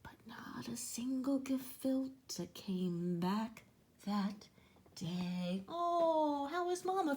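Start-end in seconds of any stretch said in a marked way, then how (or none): 0.0-5.6 whisper. A narrator's reading. Sad story moment.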